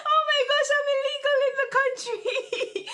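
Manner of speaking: high, loud, laughing through the words; laughing panic. Retold or imitated. Retelling her visa panic to viewers, laughing high and loud.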